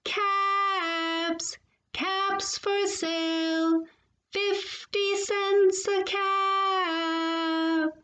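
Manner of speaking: high, slow, sustained vendor's cry; peddler's street call. A narrator's reading. Peddler calling his wares in town, drawn-out cry. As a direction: hold each word on a long level note. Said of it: "he walked back to town calling".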